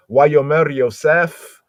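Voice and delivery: "Wayomer Yosef" spoken slowly and loudly in Hebrew, each syllable drawn out; Hebrew read aloud. Shown as a demonstration. Preacher voicing the Hebrew text for the congregation, slow and loud. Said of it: "Let's bring out the Hebrew so you can hear it".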